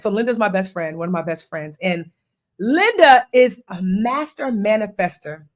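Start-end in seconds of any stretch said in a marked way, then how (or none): none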